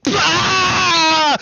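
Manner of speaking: a short, sharp mock cry of pain. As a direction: yelp as if the hand is cut. Mimes hand being chopped off.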